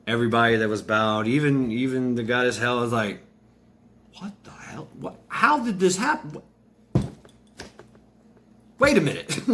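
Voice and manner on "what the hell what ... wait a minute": high, incredulous sputtering; hammed-up flabbergasted reaction; stunned disbelief. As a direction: start airy, then burst out high in shock. Goddess and crowd stunned at refusal.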